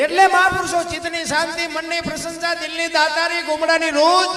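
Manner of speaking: loud, high preaching list. Acted. Preacher listing virtues to his listeners, loud and high. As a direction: call out each gift to the crowd.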